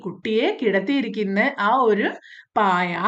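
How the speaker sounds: normal talking voice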